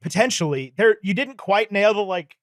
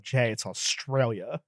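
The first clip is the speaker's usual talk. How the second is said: quiet aside; 6 semitones lower, 10 dB quieter, slower than usual. Retold or imitated. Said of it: "I was sitting there like".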